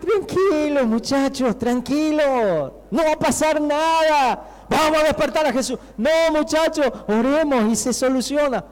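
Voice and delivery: high, insistent calling to the group; acted pleading, pitched well up; urgent reassurance. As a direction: call out over a storm to calm the others. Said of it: "¿Qué le diría?".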